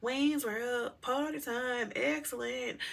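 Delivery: high-pitched, drawn-out movie catchphrase; Wayne's World impression. Joking to lighten the crash story, high catchphrase voice. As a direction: quote the slacker comedy in a put-on high voice. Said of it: "If y'all seen Wayne's World".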